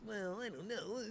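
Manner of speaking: mock wavering senator. Mocking hesitant senators.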